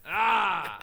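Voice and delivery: a hammed-up anguished wail at his friend; mock wail. Co-host mocks fanboy story.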